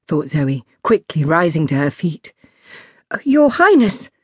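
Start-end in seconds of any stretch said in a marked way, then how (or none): 3.1-4.1 high, a little louder startled greeting; flustered Zoe. Acted. Zoe startled by the princess, flustered high greeting. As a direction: caught off guard, bow and stammer.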